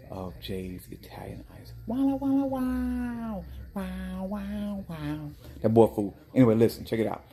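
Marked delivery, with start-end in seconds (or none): 1.9-5.3 high drawn-out wows, fading; amazed wows. Real, not acted. Vlogger marvels at a foolish boy, high wows. Reacting to a fool.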